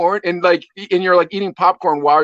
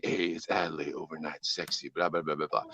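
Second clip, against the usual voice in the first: much lower and much softer, a mock seductive late-night DJ; sexy radio voice; 13 semitones lower, 16 dB quieter than usual. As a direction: purr it like a cheesy overnight radio host. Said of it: "he can still do the sexy voice".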